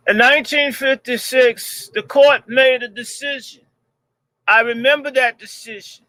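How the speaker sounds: quoting the video as a mock floor speech; imitating John Lewis speech. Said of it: "that thing where he says".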